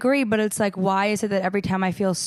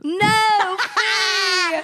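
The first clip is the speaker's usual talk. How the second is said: high shocked laugh while protesting; 11 semitones higher, 4 dB louder than usual. Real, not acted. Host protesting co-host's joke, laughing. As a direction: scold her friend through laughter. Friend jokes Texans stupid.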